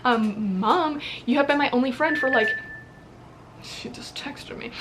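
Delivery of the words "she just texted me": near-whispered, slow aside; barely voiced interjection; hushed aside. Real, not acted. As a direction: drop to a whisper, as if to yourself. Breaks off on seeing a text.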